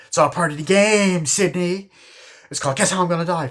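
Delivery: high, loud villain's taunt; mock taunting killer. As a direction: quote the killer toying with the victim. Killer taunting victim, quoted.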